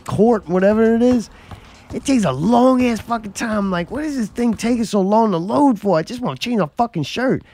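high-pitched, worked-up griping; fed-up gamer complaint, high and insistent; exasperated rant. Real, not acted. Exasperated rant about game's loading.

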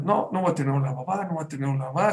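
ordinary talk, nothing marked

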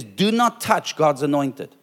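slow, measured words of warning; very careful warning. Retold or imitated. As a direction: say it slowly and very carefully. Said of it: "God says very carefully".

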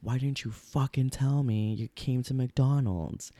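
slow, drawn-out angry question; angry demand. Retold or imitated. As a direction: spell out each word in anger. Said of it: "the first thing I say is like".

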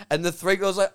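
usual speaking voice

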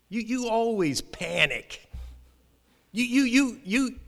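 high-pitched, drawn-out mock reproach; smart aleck. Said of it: "he wasn't saying it the smart aleck way I'm saying".

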